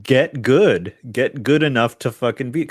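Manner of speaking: jeering at a struggling player; mock taunt. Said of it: "I'm like".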